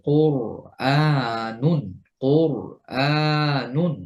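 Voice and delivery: কোরআনুন very slow, with the র thick and dense; heavy ra. Shown as a demonstration. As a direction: draw out কোরআনুন with a full র. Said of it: "ফলে মোটা শোনা যাবে".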